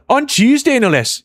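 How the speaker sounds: high-pitched mock accent, nearly Irish; Irish-sounding accent. Streamer joking for chat, in a put-on Irish-sounding accent. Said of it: "That sounds more Irish than anything".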